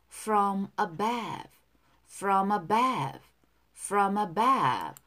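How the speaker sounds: drawn-out repeat for learners; very slow drill. Shown as a demonstration. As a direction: stretch from above very slowly. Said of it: "두 번씩 따라 할게요". (아주 천천히 늘려 읽기)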